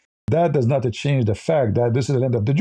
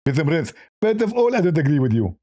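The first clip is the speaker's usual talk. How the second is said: Zakir Naik. Acted. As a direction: lisp it like a mock phone guest. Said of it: "Even Zakir Naik, if we call him".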